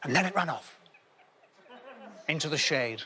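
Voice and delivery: dreamy, wistful fairy-tale telling; hammed-up grandparent recounting a mythical beast; storybook narration. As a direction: spin it like a bedtime legend.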